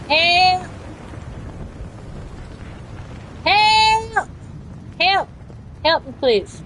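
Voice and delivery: high, pleading cry of help; calling for help. Pretend. Downed streamer calls teammates for help, high pleading cries.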